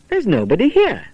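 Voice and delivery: high, taken-aback voice; puzzled at the empty cottage; surprised. Acted.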